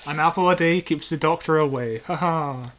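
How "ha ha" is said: ordinary talk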